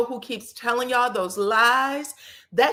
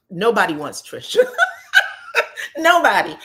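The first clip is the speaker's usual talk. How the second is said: laughing mockery; 3 semitones higher, 6 dB louder than usual. Real, not acted. Recapper scoffing at the husband's claim, laughing. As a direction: crack up at the absurd claim, then punch the last word.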